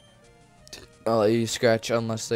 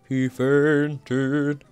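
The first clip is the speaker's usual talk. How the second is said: higher, drawn-out put-on voice; silly announcement; 4 semitones higher, 4 dB louder than usual. Repeating that the Pokemon fainted, high silly drawl.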